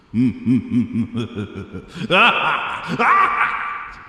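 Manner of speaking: rising evil cackle; evil laugh. Pretend. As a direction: cackle like a scheming villain. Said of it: "Let me do".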